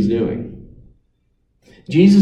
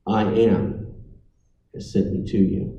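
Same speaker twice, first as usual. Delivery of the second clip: voice of God; 6 semitones lower, 7 dB quieter than usual. Acted. God speaking to Moses.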